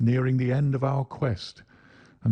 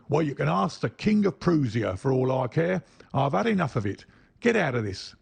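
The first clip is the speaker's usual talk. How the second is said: higher, angry dismissal; 5 semitones higher than usual. Acted. Salesman ordering the little man out, angry. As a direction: chase him off with scorn. Salesman drives him away.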